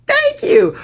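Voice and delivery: high-pitched put-on thank you; mock-polite comeback. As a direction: play a high, over-polite dodge. Imagined comeback to awkward question.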